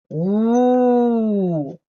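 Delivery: drawn-out mock ghostly ooh; a playful spooky moan.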